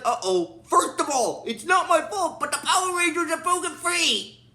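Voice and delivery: high, defensive cartoon villain impression; Rito. Imitating a startled villain reporting escaped Rangers, defensive. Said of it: "he's like".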